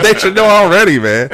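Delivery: loud, high-pitched laughing objection; laughing protest. Real, not acted. Fan teases host for asking his pick, laughing protest.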